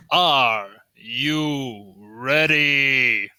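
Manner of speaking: very slow, stretched ring call; big arena announcer hype. Acted. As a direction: drag out each word to rouse the crowd. Announcer repeats to the crowd.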